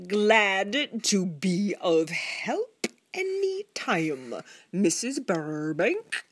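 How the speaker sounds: every syllable drawn out, primly superior; prissy Mrs Teagle. Acted.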